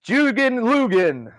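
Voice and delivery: high, drawn-out announcer call; show announcer parody. Acted. Mocking a live comedy show opening, high stretched announcer. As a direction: sing out the show intro slowly.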